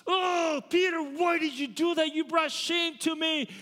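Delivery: mock furious preacher scolding a disciple; raging Jesus. Shown as a demonstration. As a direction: rage at Peter full force. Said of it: "He's not full on raging".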